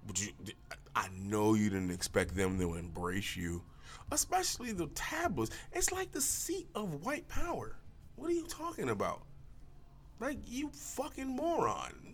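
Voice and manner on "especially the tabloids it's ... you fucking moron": high mock scorn. Mocking Meghan Markle.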